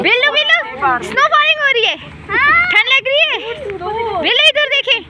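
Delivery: very high sing-song calling; high baby-talk voice. Acted. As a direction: call the little one in a high voice.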